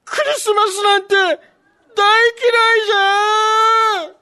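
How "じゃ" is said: put-on whiny crying old man. Santa whining he hates Christmas.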